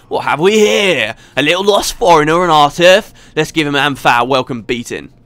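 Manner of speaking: hooligan in a shaky attempt at London; attempted London accent. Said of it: "trying to go for a London thing".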